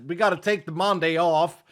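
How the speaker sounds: normal talking voice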